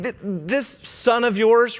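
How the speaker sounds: mock the jealous brother's whine; resentful older son. Preacher mimics older son resenting his brother, high sneer. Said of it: "He's like".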